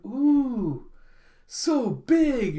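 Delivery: high, loud swooping wonder; awed excitement. Retold or imitated. Child seeing the giant cruise ship, awed excitement. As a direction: marvel loudly like an amazed child.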